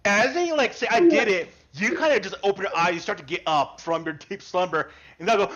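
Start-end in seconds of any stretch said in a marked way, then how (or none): 0.9-1.5 put-on loud childish boast; excited kid cry. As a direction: cheer like a giddy kid. Kids repeating their boast.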